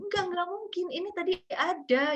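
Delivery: high-pitched pleading denial; acted bewildered, tearful protest; retold tearful confusion. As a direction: re-enact refusing to believe it, voice way up. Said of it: "sambil saya nangis, saya bingung".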